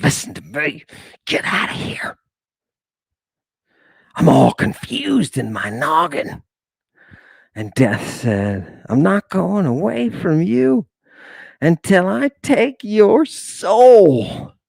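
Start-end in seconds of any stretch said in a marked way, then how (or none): 0.0-0.8 lightly voiced old prophet, half whispered in the middle. Abraham pleading with the listener. 1.3-2.2 hushed urgent whisper. Acted. Abraham whispering to leave. 4.2-6.4 lightly voiced old prophet, half whispered in the middle. Abraham pleading with the listener. 8.9-14.5 higher, slow, drawn-out menace. Acted. Death threatening Abraham, slow and menacing.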